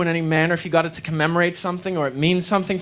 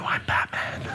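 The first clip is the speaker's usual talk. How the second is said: Batman voice; 7 dB quieter, slower than usual. Pretend. Batman gravel voice in mirror.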